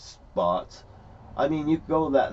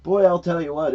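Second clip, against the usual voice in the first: ordinary talk, nothing marked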